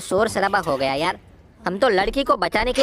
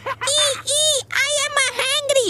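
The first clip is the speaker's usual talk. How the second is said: imitating the ghost child; much slower than usual. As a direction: drag out the words like the creepy game child. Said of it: "ये तो मैं भी बोल सकता हूँ यार".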